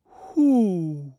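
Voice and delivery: long drawn-out question; lightly voiced bear asking. A narrator's reading. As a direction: ask it slowly as a storybook bear.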